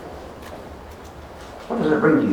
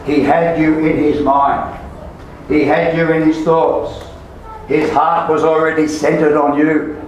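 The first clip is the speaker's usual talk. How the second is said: raised, insistent sermon delivery; impassioned preaching; 4 semitones higher than usual. Real, not acted. Preacher urges beloved to take verse to heart, impassioned preaching. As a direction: drive it home, phrase by phrase.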